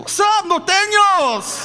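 hammed-up street challenge; mock gang greeting. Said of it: "throwing gang signs".

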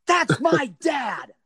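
high, drawn-out mock yell; imitated indignant cry, half laughing; son's protective shout. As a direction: shout it slowly while breaking into a laugh. Son shoving past police, acted.

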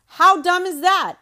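mock-exasperated cry; incredulous exclamation. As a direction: blurt it in disbelief at herself. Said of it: "She asks".

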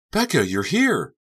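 high, slow, delighted call; excited greeting. A narrator's reading. Hostess greeting at party.

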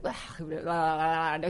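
slow, mock sleepy blah blah; sleepy mumble. Husband mumbling half asleep at three in the morning, slow mock drone. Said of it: "He's sleeping".

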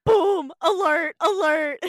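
loud, high one-word blasts; mock emergency alert. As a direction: shout it like an alarm going off. Joins the parody news alarm.

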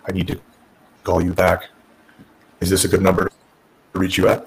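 low deadpan monotone; Tommy Dreamer. Retold or imitated. Boss putting off a caller, monotone. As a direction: recite it in an imitated low, uninterested drone. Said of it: "This super monotone voice".

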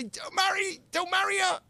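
very high, strained mock cry; mock frantic plea. Rabbi pretends to tie up the son, high mock pleading. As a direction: squeal it like an absurd, desperate order.

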